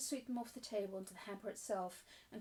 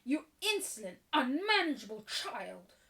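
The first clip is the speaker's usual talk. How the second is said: loud, raised angry scolding; infuriated; 3 semitones higher, 9 dB louder than usual. Acted. Mistress shakes Sarah, infuriated. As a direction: burst out at a naughty child. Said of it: "Miss Minchin was infuriated".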